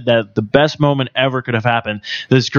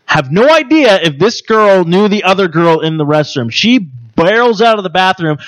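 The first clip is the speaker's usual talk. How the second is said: normal talking voice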